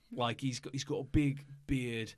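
normal talking voice

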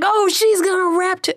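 high-pitched, hammed-up surprise; mock amazement. Rapper mimics crowd who took her for backup dancer, amazed.

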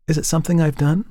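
quick, lightly voiced model; young woman voice.

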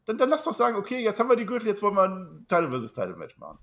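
high, loud, fired-up pitch; animated fan rant; excited rant. Real, not acted. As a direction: pitch the dream match with rising excitement.

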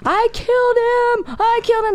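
put-on very high, wailing little-girl voice; panicked crying kid. Girl wailing to mom over her dying guinea pig, imitated as a high sobbing child. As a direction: cry it out like a guilty, sobbing child. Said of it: "Naya's going".

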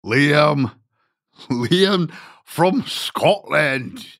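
hammed-up Scottish accent, pitched high and drawn out slowly; a deliberately terrible Scottish accent, stretching the name Liam; bad Scottish accent. Host greets Scottish caller Liam in a mock Scottish accent. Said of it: "That is the worst Scottish accent in the world".

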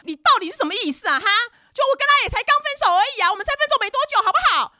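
high, rapid screechy scolding; mock furious tirade. [name] confronts the friend about the recent breakup, mock shrieking rage. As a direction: mimic an outraged ex demanding answers. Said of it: "继续破口大骂".

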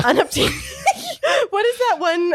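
laughing high shout. Real, not acted. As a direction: burst out laughing on the word. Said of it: "laughing at the word".

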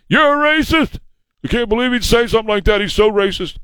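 high, fast, huffy mock outrage; mock indignant accuser. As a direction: play a scandalized scold. Mocking Biden's accusation.

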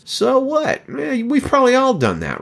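high-pitched acted shrug; dismissive. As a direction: brush it off as no big deal. Voicing a shrug at name-calling.

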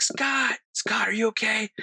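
high-pitched calling out for a friend; retold yelling. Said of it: "I'm, like, yelling for Scott".

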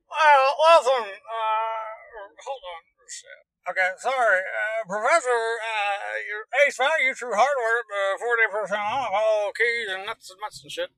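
slow, drawn-out hillbilly character; other hillbilly. Shown as a demonstration. Hillbilly hardware man talking to the Professor, slow character voice. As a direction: stretch it out like a befuddled store clerk. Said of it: "the hillbilly, other hillbilly".

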